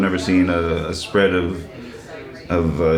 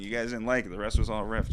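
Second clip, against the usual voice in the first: usual speaking voice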